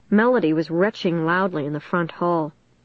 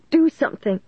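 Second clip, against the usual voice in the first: high-pitched panicked cry; frantic, pitched far up; shrieking; 8 semitones higher than usual. A narrator's reading. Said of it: "she shrieked".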